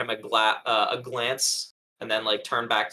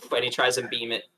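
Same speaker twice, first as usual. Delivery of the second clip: ordinary delivery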